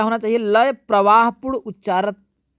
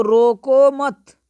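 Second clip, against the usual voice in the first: रोको मत run together and raised, stopping after मत; pause after मत; 6 semitones higher, 6 dB louder, much slower than usual. Shown as a demonstration. Teacher shows the other meaning, pause after मत. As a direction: join मत onto रोको, then halt. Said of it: "यहाँ पर विराम ले लिया".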